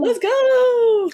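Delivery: high, loud hype cheer; excited shout. Pretend.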